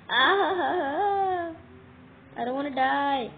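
high, loud cry of fear; loud panicked wail; frightened cry. Real, not acted. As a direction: cry out scared for your life. Being attacked, fearing death.

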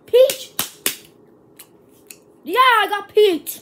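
loud, high happy yell; excited shout. Real, not acted. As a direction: cheer it out with relief. Relieved at good flavor.

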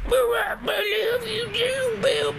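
put-on very high, slow crying old woman voice; old lady voice. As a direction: wail it like a swooning old granny. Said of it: "it's just been me doing her voice".

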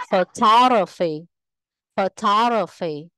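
phoTOGraphy, with TOG punched; third-from-last syllable stress. Shown as a demonstration. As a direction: hit the second syllable of photography, said twice. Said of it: "rơi vào âm tiết thứ ba từ dưới lên".

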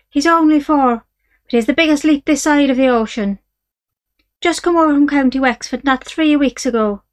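a wheedling Irish trader; Irish horse dealer. Acted. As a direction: sell the horse with easy Irish charm. Irish dealer's sales pitch.

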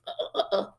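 small voiceless choking gasps; choke, struggling for breath; choking noise. A narrator's reading. Aunt clutches her throat, choking. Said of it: "made a choking noise".

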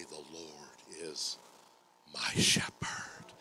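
slow, hushed "The Lord is my shepherd", "shepherd" sinking to breath; weighted personal declaration. Shown as a demonstration. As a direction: let "my shepherd" land softly after a pause. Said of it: "is when you say".

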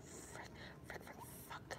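hissed whisper of frustration; frustrated whisper. Real, not acted. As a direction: whisper it in annoyance.

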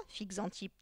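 ordinary delivery

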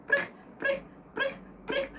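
squeaky high hop sounds; high jumping hops. Shown as a demonstration. Teacher leads the children jumping like a fox, with squeaky hops. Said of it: "А давайте і ми пострибаємо".

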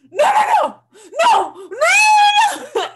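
mother yelling at the video of a bungee jump; mom yelling no. Retold or imitated. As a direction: beg the screen to stop, half laughing. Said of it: "She's yelling at the screen".

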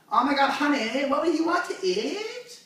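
high mock girly voice, rising to the end; exaggerated squeaky couple talk; annoying girlfriend. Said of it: "there's two of them".

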